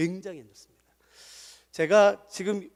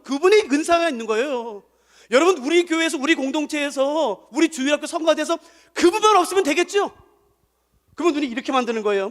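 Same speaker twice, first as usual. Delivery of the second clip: usual speaking voice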